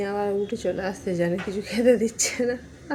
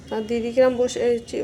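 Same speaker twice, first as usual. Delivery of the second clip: ordinary talk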